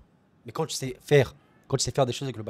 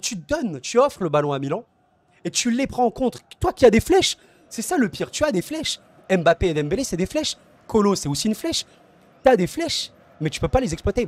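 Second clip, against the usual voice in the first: much higher and louder, worked up over wasted speed; emporté; 6 semitones higher, 5 dB louder than usual. Real, not acted. Exasperated rant about fast forwards. (emporté, voix haute et forte)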